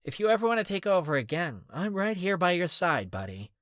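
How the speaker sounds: teasing goof. A narrator's reading. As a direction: toss it off with a smirk in the voice. Alistair teasing the group.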